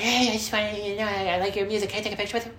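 high, nervous mock fanboy voice; awkward fan. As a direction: pipe it up, timidly asking your idol. Acts awkward fan asking for picture.

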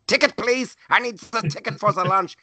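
high-pitched, exaggerated German accent; huge German accent. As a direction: imitate a German lunch lady demanding tickets. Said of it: "she had this huge German accent".

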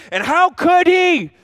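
over-the-top offended boss; mock outrage. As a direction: squeal in theatrical offense. Pastor acts offended at Dan.

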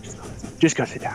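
barely voiced hushed brush-off; mouthed. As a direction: wave him off in a near-silent mouth. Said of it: "just be like".

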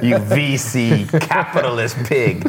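slow, drawn-out playful insult; mock outrage. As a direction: hurl a hammed-up insult, slowly. Mock rejection of venture capitalist.

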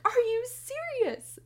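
high, slow, thrilled question; giddy daughter. Retold or imitated. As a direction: squeal it like a giddy girl. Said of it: "my daughter just was giddy".

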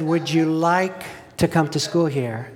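each word drawn out, deliberate; spoke slowly. Retold or imitated. Friend Dana repeats his offer, slow and deliberate. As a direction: spell it out slowly, like to someone not listening. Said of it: "he got in my face and spoke slowly".

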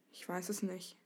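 soft, downcast dragon; dejected. A narrator's reading. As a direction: say it quietly, heavy-hearted. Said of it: "meinte der Drache bedrückt". (bedrückt und leise)

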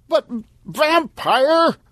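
very high, drawn-out plea; anguished slow cry; desperate shout. Acted. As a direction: stretch the name out in despair. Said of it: "Stan shouted".

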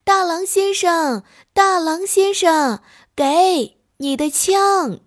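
loud, high, slow shouts after him; drawn-out high yelling; calling out. Acted. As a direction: call after someone running away. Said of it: "追上去喊".

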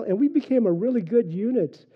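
usual speaking voice

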